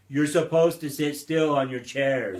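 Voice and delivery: loud mock telling-off; playful scolding. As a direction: scold the kids loudly, in play. Mock scolding of listening children.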